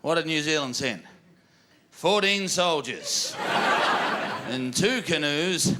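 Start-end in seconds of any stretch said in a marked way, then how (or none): 1.9-5.7 slow, spaced punchline. Real, not acted. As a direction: drag out the punchline. Punchline delivered slowly.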